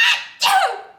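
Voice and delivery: a loud, high, explosive achoo; huge sneeze. Acted. Wolf sneezes the straw house down with a huge achoo. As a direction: blast out a giant sneeze. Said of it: "and I sneezed".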